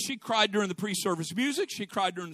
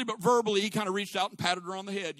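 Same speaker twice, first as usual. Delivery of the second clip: usual speaking voice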